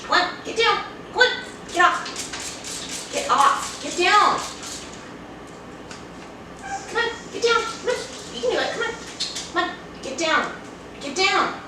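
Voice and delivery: high, soft pleading; coaxing a pet. Real, not acted. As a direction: coax the cat gently down. Coaxing cat off her.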